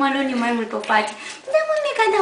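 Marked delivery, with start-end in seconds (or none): 1.5-2.2 high coo to the little one. Real, not acted. Cooing to the little puppy.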